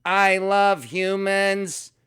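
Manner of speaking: high, slow, eerie robot voice saying it too sweetly; a creepy android forcing out affection, drawn out high; robot Sophia voice. Retold or imitated. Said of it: "and she went".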